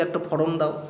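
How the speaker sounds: "ফর্ম" as phorom, said low and soft; quiet casual request with "ফর্ম" as pho-rom; uninformed mispronunciation. Retold or imitated. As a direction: slip an extra o into "ফর্ম". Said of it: "জানি না বলে ফর্ম বলি".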